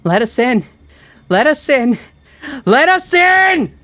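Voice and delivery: escalating high-pitched screams. A narrator's reading. Mom shouting over and over at the door, frantic screaming. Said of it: "her voice growing louder and louder".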